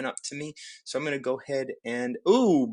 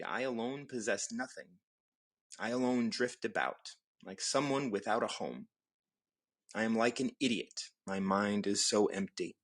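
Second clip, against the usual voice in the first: calm reading; 4 semitones lower than usual. Acted. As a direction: read the verse calmly and evenly. Reads Tao chapter aloud.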